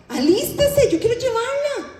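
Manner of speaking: high, eager urging; insisting husband. Retold or imitated. Father urging the mother to get ready for the dance, high eager.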